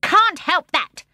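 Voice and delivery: high, loud, slow retort; the seal dismisses Michael; sour seal. A narrator's reading. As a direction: snap back without pity. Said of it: "said the seal".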